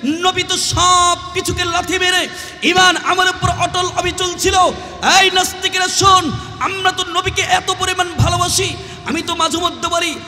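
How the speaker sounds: high, fervent sustained shout; full-force preaching. Acted. Preacher challenging atheists out of love for the Prophet, fervent shout. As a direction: thunder at the opponents, high and relentless.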